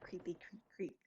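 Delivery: spooky hushed whisper. Real, not acted. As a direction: breathe it like a ghost story. Eerie dark place.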